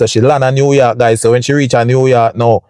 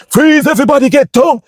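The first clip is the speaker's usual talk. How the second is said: much higher, half-shouted police command; mock police raid shout; 14 semitones higher than usual. Federal agent bursting into the house, mock command. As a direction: play the fed storming in, not full volume.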